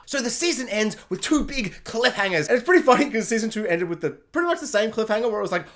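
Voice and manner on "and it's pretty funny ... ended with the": normal talking voice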